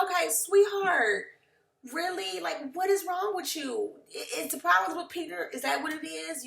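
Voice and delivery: incredulous condescension. Retold or imitated. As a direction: talk down to someone overreacting. Acted reaction to an overreaction.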